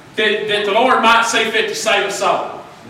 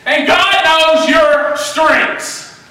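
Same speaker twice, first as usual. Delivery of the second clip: full-force preaching; 7 semitones higher, slower than usual. Real, not acted. Preacher rebuking the congregation's excuses, rising shout. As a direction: drive it home to the church like a fire sermon.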